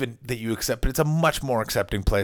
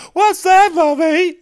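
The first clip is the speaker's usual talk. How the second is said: put-on much higher, louder small-child voice; curious child voice; 10 dB louder, slower than usual. Child pointing at a fat person, asking mommy in a high voice. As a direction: pipe up like a child pointing at someone. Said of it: "honest kid thing of pointing".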